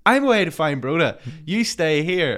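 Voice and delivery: brisk telling-off; lightly voiced Thomas. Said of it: "he goes".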